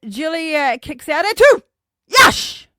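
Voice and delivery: loud, high burst of celebration; excited call. Real, not acted. Podcaster cheering Julia's kick-out at two, excited shout.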